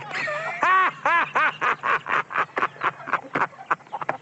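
high laughter after the punchline; big laugh at own joke. Real, not acted. Joke teller laughing at his punchline to Frank.

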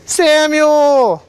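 high, far-off call of Samuel's name. Acted. God calls the sleeping boy Samuel, high drawn-out call.

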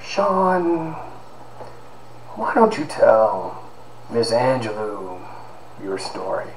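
a soft, slow impression of an eccentric old academic; eccentric professor's voice. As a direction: draw out the invitation like a quirky Santa-like professor. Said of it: "he kinda talked like this".

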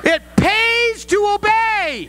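high, slow shouted punchline; emphatic preaching. Real, not acted. Preacher to congregation on learning obedience, emphatic shout. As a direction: proclaim the moral at full pitch, slowly.

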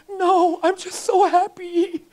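put-on high, loud little kid sobbing with joy; a small child overwhelmed with happiness; happy crying child. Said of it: "I'm like".

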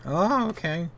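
high-pitched timid woman; startled woman. Acted. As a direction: answer high, as the rescued woman. Voices rescued woman fleeing.

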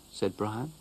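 ordinary delivery, nothing marked

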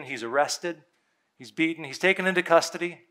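ordinary talk, nothing marked

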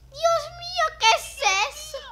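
high startled cry of alarm; startled shriek. Real, not acted. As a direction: shriek it out in sudden fright. Sudden fright in game.

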